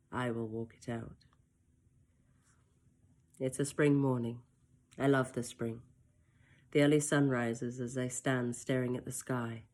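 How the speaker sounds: plain reading voice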